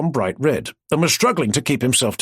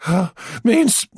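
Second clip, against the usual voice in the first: spluttering; 11 semitones higher than usual. Acted. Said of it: "He spluttered".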